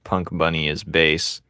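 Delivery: ordinary talk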